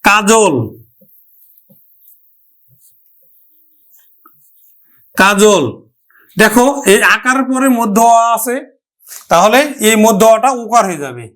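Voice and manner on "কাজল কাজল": কাজল with u sound, ka-jul; teacher models কাজল; pronunciation demo. Shown as a demonstration. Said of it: "যেমন".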